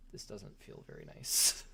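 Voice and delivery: ordinary delivery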